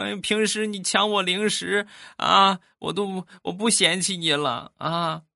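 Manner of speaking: put-on high, tearful child's voice; crying little nephew. Nephew forgiving his uncle while crying, high child voice. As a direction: sob out a child's promise.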